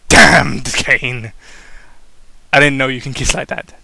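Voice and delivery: a hint of breathlessness; panting. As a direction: gasp it out after the kiss. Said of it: "Daniel panted".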